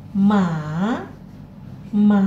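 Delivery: ma dipping down then up; third tone ma. Shown as a demonstration. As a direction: dip ma and rise. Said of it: "cara bacanya turun kemudian naik".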